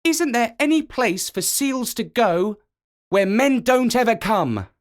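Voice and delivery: put-on high young seal calling out. A narrator's reading. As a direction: call across the beach, pleading. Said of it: "So he called out".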